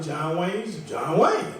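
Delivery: normal talking voice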